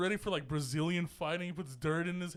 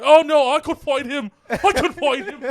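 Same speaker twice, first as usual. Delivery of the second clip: high, loud mock Irish brag; hammed-up cocky fighter voice; mock McGregor; 7 semitones higher, 13 dB louder than usual. Mocking McGregor eager to fight a fan, cocky Irish brag. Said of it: "McGregor's all like".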